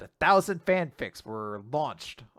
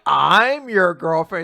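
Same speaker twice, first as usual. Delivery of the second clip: insistent declaration; 4 semitones higher, 11 dB louder, slower than usual. Retold or imitated. Fuko voiced, insistent.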